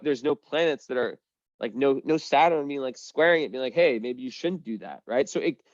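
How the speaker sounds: usual speaking voice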